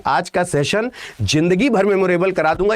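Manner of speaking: ordinary talk, nothing marked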